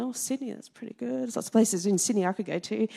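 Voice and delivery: very soft aside to oneself; hushed musing. Retold or imitated. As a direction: think it aloud under your breath. Retold pleased thought to herself.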